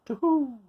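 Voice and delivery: owl hoot. Acted. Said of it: "I cry as I look for food".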